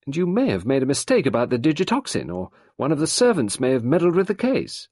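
high, insistent rebuttal; insistent arguing. A narrator's reading. Sarah argues against Gerard's findings.

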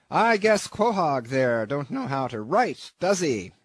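put-on brash old skipper, loud and blunt; gruff old captain. Peleg asking Queequeg how he signs, loud and blunt. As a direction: bark at the recruit like a salty captain.

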